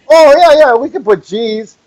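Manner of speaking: high, bright-toned clerk reply; lightly voiced eager clerk; cheery clerk. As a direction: brush it off like a cashier. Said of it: "she goes".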